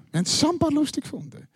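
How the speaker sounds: high, quick amused echo of the line; giddy repeat. Shown as a demonstration.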